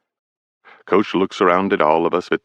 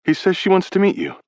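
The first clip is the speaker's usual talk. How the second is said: higher, uneasy teammate voice; anxious Brady; 13 semitones higher than usual. A narrator's reading. Teammate Brady tells Carlson Teddy is freaking out, higher and uneasy. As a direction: shrug it off but worried.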